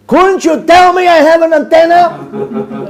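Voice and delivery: high, slow, comic scolding; mock outrage. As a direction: wail a drawn-out reproach at your students. Mock scolding of his students.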